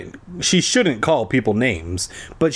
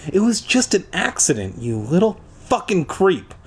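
higher, mock shriek of fury; 7 semitones higher than usual. A narrator's reading. Girl shrieking at Ricky for erasing her page, mock angry. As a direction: spit the insult out. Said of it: "Tasha shrieked".

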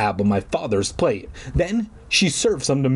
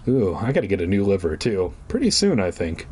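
usual speaking voice

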